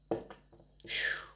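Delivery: soft breathy exhale of relief; quiet relieved exhale; whispered phew. Real, not acted. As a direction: blow the air out in a quiet phew. Exhale during reading.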